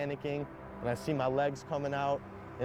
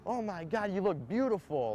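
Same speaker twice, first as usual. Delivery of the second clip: retold amazement; 6 semitones higher than usual. Doctor rushes up to revived patient.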